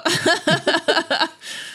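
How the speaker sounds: high squeaky laugh; giggling laugh. Real, not acted. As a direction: burst into a giggle. Laughing agreement.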